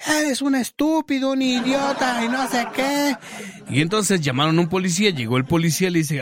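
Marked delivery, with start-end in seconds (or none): 0.1-3.2 high, slow mock outrage; imitating a furious woman, hammed-up; angry lady. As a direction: shriek like an offended old lady. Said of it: "empezó a gritar".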